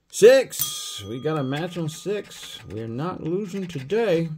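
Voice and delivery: high, loud burst of joy; excited win. Real, not acted. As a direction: celebrate the match. Finds a match on a ticket.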